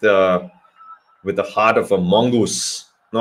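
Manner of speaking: ordinary talk, nothing marked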